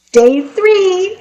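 high-pitched gleeful little man; barely contained excitement. A narrator's reading. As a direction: announce it high, bursting with anticipation. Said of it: "he could hardly contain himself".

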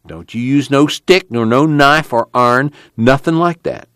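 camp committee leader. Acted.

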